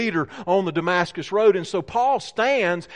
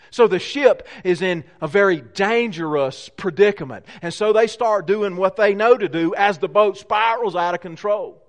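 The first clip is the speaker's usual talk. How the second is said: usual speaking voice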